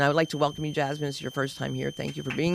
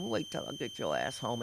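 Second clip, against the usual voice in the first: low, soft threat through a still mouth; through unmoving lips; 7 semitones lower, 11 dB quieter than usual. Retold or imitated. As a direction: threaten like a ventriloquist. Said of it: "never moved her mouth".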